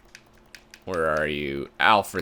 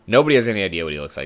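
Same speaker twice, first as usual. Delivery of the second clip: usual speaking voice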